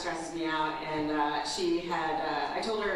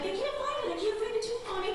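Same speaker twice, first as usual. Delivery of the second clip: put-on much higher whiny child voice; little daughter; 13 semitones higher than usual. Mom imitating her daughter, much higher child voice. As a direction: play the panicked little girl. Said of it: "And she's like".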